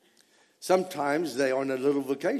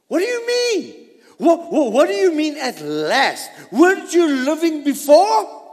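much higher and louder, demanding; loud incredulous challenge; 11 semitones higher, 9 dB louder than usual. Acted. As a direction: throw the question at them. Challenging congregation loudly.

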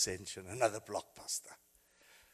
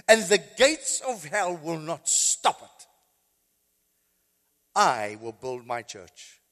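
louder, slow preaching of Christ's words; 6 semitones higher, 11 dB louder, slower than usual. Acted. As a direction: proclaim it to the church. Preaching the resurrection.

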